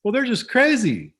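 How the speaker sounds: high, loud mock dismissal; dismissive thought. Playwright waving off the critics at first, high dismissive exclamation. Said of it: "I thought".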